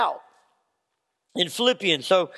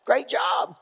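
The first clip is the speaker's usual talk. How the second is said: flat mock praise; 3 semitones higher, slower than usual. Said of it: "We're like".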